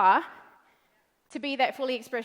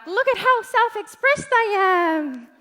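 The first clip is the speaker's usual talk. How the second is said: higher, louder smug boast; mock show-off; 7 semitones higher, 6 dB louder than usual. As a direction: brag like a showy group post. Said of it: "and you're like".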